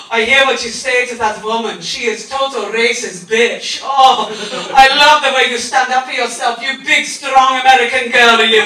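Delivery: leering mock German accent; German man's accent. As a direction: imitate an admiring German dude, accent thick. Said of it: "hairy German dude in a Speedo".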